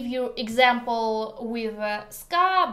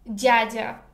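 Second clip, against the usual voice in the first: normal talking voice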